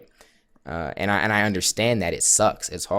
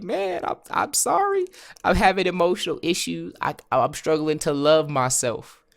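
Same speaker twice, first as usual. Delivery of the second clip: high-pitched mock pleading; pleading tenant; 9 semitones higher than usual. Tenant making excuses to the landlord on the first, high mock plea.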